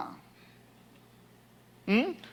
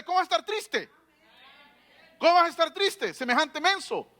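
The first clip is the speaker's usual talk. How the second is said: fervent preaching; 5 semitones higher than usual. Real, not acted. Preacher scolding the sad servant before the king, fervent. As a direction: press the point with rising fire.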